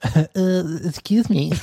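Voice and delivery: high nasal-free nerdy voice; nerd voice. Pretend. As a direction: put on a nerdy voice for a moment. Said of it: "You didn't even make your nerd voice".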